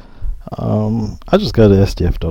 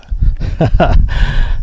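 laughing; 7 semitones higher, 4 dB louder than usual. Real, not acted. Wife throws towel over head.

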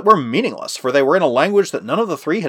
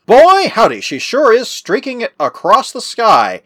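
high, loud, drawn-out holler; excited yell; 9 semitones higher, 6 dB louder, slower than usual. A narrator's reading. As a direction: yell it out in amazement, stretching the words. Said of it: "he yelled".

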